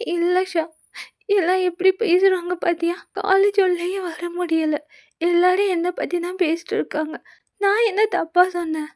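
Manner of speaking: a hint of a hurt girl; tearful complaint. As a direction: complain through mild tears. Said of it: "அழுக தாங்கல".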